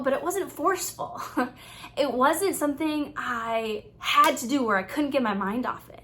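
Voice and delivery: ordinary talk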